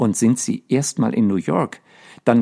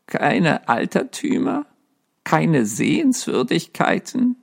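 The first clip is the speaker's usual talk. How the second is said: higher, rising mock disbelief at "Keine Altertümer?"; lightly voiced ghost echoing in disbelief; incredulous ghost; 6 semitones higher than usual. A narrator's reading. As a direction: throw her words back, offended. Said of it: "Erwiderte das Gespenst".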